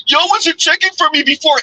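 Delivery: high mocking whine; mocking complaint. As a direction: pitch it up, mimicking her.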